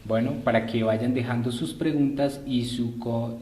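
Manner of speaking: ordinary talk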